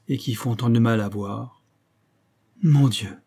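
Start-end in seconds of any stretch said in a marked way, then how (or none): 2.6-3.1 a cry of pain, lifted; first anguished cry of «Mon Dieu!», raised but held back. A narrator's reading. Englishman cries out to his guests, a first restrained anguished cry. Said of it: "s'écria-t-il".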